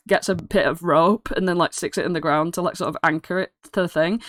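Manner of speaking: usual speaking voice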